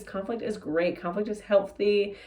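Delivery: ordinary talk, nothing marked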